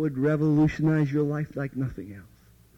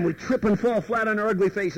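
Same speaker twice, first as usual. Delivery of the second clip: ordinary talk, nothing marked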